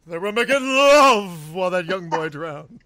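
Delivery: slow, theatrical accusation; hammed-up villain reproach, easing off after the swell; Mrs. Voorhees. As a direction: stretch LOVE out with a big swell. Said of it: "Do we get a Missus Voorhees?".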